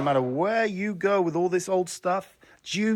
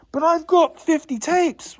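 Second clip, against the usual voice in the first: slow, high-pitched mock whine; mock-protesting customer; 14 semitones higher than usual. Vlogger imitating a customer with too many tapes, mock protest. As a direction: drag out the customer's objection up high.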